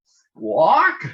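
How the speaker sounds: high, loud squawk like a jay; bird squawk. Acted. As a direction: screech it out like a startled bird. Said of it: "called Blue Jay".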